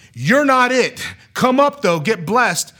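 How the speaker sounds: high, loud pulpit call; preacher calling out. Retold or imitated. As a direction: imitate a preacher summoning from the front. Preacher calls out from the front.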